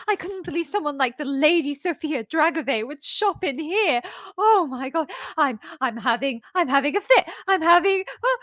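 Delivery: high, loud, overexcited babbling; gushing fan. Acted. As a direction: fangirl falling apart with excitement. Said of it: "it's not very convincing".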